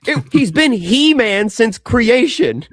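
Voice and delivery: loud, high, slow exasperated protest. Real, not acted. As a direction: throw your hands up at the absurdity. Incredulous outburst at the controversy.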